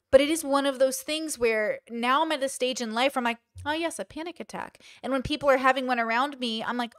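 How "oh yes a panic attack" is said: soft, higher-pitched, matter-of-course acknowledgement; unfazed recognition. Retold or imitated. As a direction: greet it lightly like an old acquaintance. Voicing her unfazed reaction to panic attacks.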